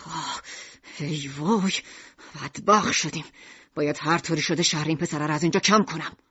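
low despairing storekeeper voice; Mishima's low dismayed voice. Acted. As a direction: groan it out like a man whose plans just fell apart.

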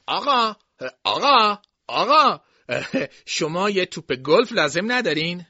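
high, loud calls to get attention; calling out. A narrator's reading. Boy calling to a golfer to sell a ball, loud high hail. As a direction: hail the golfer from across the course.

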